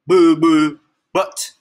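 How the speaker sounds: loud, blurted silly name; silly announced name. Pretend. Reader made to name the hippo, loud blurt. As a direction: announce it with a goofy boom.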